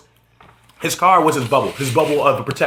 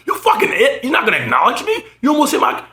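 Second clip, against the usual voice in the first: high-pitched mock outrage; imitated growing anger; 7 semitones higher than usual. Ignored man in the video gets angrier at the driver, imitated outrage. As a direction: play a man working himself up, voice jumping higher. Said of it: "now he's getting so much angry".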